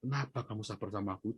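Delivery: soft, humbled retold question; subdued repentance. Repentant husband at his wife's feet, softly voiced remorse. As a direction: ask it quietly, imitating a penitent husband. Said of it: "dia nangis suaminya ini bertobat".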